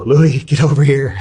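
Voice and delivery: calling him over in a panic; retold alarmed shout, drawn out; freaked-out call. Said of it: "I'm fucking freaking out".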